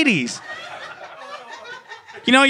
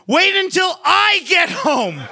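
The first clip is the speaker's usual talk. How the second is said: slow, higher mock shout of an angry dad; mock dad yell; 8 semitones higher, slower than usual. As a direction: yell it out slowly like a furious dad. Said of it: "He'd be like".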